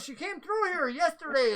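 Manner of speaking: lightly voiced NPC recounting. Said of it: "He goes".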